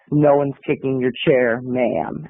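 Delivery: low, controlled reply; calm yourself and keep it down; forced calm. Retold or imitated. Moviegoer to the accusing woman, forced calm through a grin. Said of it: "I calmed me and myself and I down".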